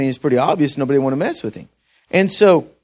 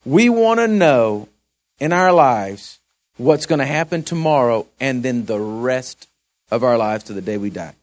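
full-force preaching; 3 semitones higher, 4 dB louder, slower than usual. Acted. Preacher exhorts the congregation that the blood is enough, full force. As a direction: drive it home to the congregation.

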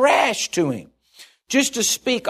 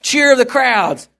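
louder and higher, a sermon climax; preaching at full force; 7 semitones higher, 5 dB louder than usual. Acted. Preacher to the congregation about the general's glory, building to a full-force climax. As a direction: lift the room on the glory of the parade.

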